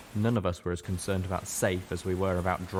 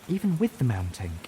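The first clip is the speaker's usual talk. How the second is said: higher, worried mare's voice; concerned; 4 semitones higher than usual. A narrator's reading. Velvet presses Steelhooves, still worried, in a higher voice. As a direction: press the worry a little further.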